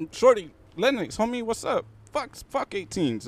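high, slow imitation of a hostile stranger; drawn-out high-pitched retold taunt; hostile outburst. Stranger insults newcomer's supposed gang, hostile.

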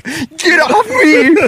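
high, slow mock yell like a little kid; hammed-up high plea, drawn out slowly; mock childish yell. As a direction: mimic him crying out like a small child. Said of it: "when you were just like".

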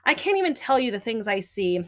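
ordinary talk

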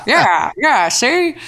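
high, brash 1930s gangster; old-timey gangster voice. Pretend. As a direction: talk like Al Capone, see. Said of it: "old timey gangster voice".

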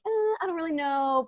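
passive aggressive. Retold or imitated. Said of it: "other people who are like".

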